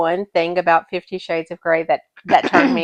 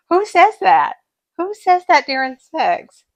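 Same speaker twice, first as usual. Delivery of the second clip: incredulous; 9 semitones higher than usual. Real, not acted. Incredulous rant about the book.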